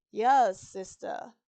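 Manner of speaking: understated answer with a growing smile, loud first word. A narrator's reading. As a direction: let a smile creep into the reply. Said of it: "her smile growing".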